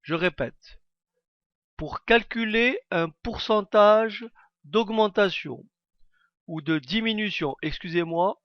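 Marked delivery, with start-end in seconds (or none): none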